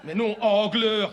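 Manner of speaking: ANGLEUR drawn out slowly; exasperated, slow stress on Angleur; insistent repetition. Acted. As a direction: stretch Angleur as if to someone not understanding. Insisting on town name.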